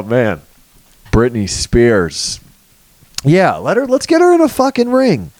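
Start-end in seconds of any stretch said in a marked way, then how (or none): 3.2-5.3 much higher, squealing hype; excited outburst. Real, not acted. Comic pitching a [name] fight, much higher and gleeful. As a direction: squeal it with glee.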